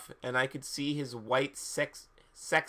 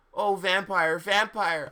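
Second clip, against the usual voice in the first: high, raised exclamation; hammed-up cry, higher than usual speech; mock scream; 6 semitones higher, 5 dB louder, slower than usual. A narrator's reading. Ebony calling to Vampire, mock scream. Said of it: "I screamed".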